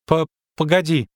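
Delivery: put-on high, halting child's voice; stammered plea to wait; hesitant little girl. A narrator's reading. Girl calling after the departing witcher, hesitant.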